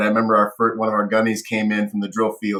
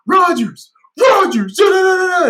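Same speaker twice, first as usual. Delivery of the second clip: high shouted calls of a name, then babbling; hollering a buddy's name across the bar; loud tipsy greeting; excited drunk yelling; 8 dB louder than usual. Retold or imitated. Drunk recruiter greeting him.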